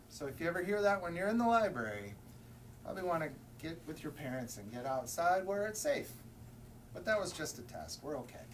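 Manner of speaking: usual speaking voice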